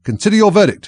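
plain reading voice

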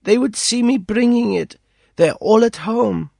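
put-on high girlish voice, worried; a young girl afraid of being seen; girl's voice. A narrator's reading. Girl explaining her fear of being seen, high girlish voice.

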